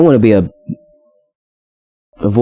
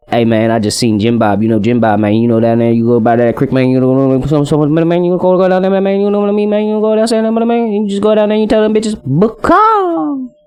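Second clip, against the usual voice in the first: run-on country rambling, climbing ever higher; country accent; 6 semitones higher than usual. Shown as a demonstration. Telling a buddy about the creek, rambling country accent. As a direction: build from usual pitch to a high country holler. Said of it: "talking in a country accent".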